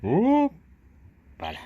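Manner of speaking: high, loud, long drawn-out hoppala; playful hoppala. Pretend. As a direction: stretch it out like lifting a baby. Lifting the baby animal up playfully.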